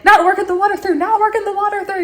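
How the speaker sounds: high, urgent rush; frantic. Retold or imitated. Woman in shower as the phone rings, frantic.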